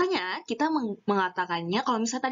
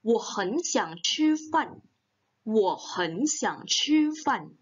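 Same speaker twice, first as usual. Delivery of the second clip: "Wo hen xiang chi fan" spoken very slowly; slow model sentence; much slower than usual. Shown as a demonstration. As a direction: model it clearly, syllable by syllable. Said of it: "kita bilang nih". (ucapkan "Wo hen xiang chi fan" sangat pelan)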